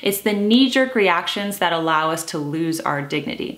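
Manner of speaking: ordinary talk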